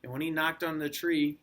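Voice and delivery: ordinary delivery, nothing marked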